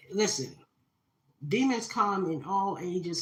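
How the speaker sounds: ordinary delivery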